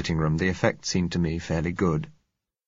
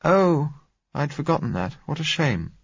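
disappointed exclamation; 9 semitones higher than usual. A narrator's reading. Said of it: "she exclaimed disappointedly".

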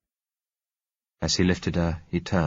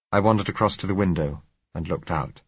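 ordinary delivery

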